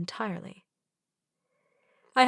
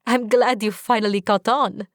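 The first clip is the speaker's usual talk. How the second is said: teasing; 2 semitones higher, 5 dB louder than usual. Acted. Teasing reply.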